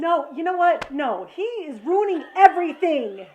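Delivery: indignant protest. Real, not acted. Co-host objects to her husband spoiling everything, indignant. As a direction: protest loudly that it's all being ruined.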